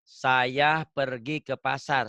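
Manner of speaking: "Saya pergi ke pasar" diucapkan sangat lambat, kata demi kata; contoh kalimat pelan. Shown as a demonstration. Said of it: "Ini kalau dalam bahasa Indonesia kan". (ucapkan pelan-pelan seperti mendikte)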